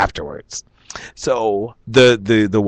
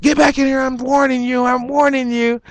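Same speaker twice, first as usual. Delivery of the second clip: high, shrill-free warning pitched up; mock threat; 13 semitones higher than usual. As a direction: warn him like a drunk losing patience. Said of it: "get madder and madder".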